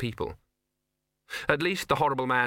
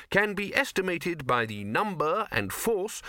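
slow, raised, pedantic reading; bursar reading aloud; 7 semitones higher, slower than usual. Acted. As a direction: read it like a fussy wizard scholar.

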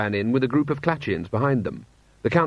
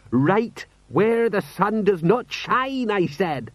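slow, high, put-on marvelling; pride or dread; 10 semitones higher, much slower than usual. Sergeant repeats his insult to himself, proud or dreading. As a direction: hold the phrase up high and stretch it. Colon relives his defiance.

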